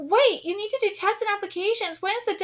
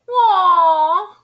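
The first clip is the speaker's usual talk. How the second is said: loud aww; 2 semitones higher, 11 dB louder than usual. Real, not acted. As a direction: coo loudly at something cute. Fan reacts to cute photo.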